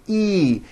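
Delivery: "E" as an isolated high ee sound; high vowel. Shown as a demonstration. Teacher isolates the high vowel sound, "E" said as clear ee. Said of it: "that has a high vowel sound".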